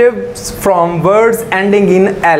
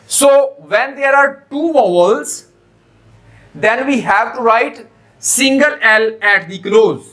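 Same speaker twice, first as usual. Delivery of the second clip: very slow, deliberate explanation; slow teacher explanation; slow rule reading; much slower than usual. Shown as a demonstration. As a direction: lay out the rule slowly for the class.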